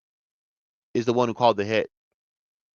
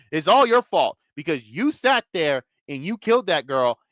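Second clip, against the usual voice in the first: accusing rant; 6 semitones higher than usual. Pretend. Rant blaming Kingpin.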